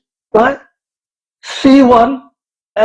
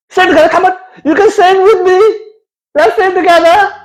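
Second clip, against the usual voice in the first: patronising teacher; 9 semitones higher, much faster than usual. Pretend. Mock teacher coaxing a grown man to spell. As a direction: coax him like a toddler. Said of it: "coaxing like a teacher".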